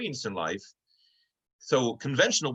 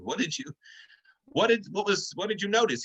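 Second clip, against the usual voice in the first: exasperated demand, pitched much higher; fed up, pressing the pig for an answer; frustrated; 9 semitones higher than usual. Acted. Friends to the pig who noticed nothing, exasperated. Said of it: "So they're getting frustrated".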